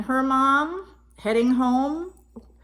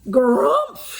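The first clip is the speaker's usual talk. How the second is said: bear's grumph; 3 semitones higher, 5 dB louder than usual. Acted. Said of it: "she cried".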